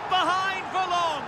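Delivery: high shouted call; excited shout. Real, not acted. Commentator shouting as the equaliser arrives. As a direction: burst out as the lead is cancelled.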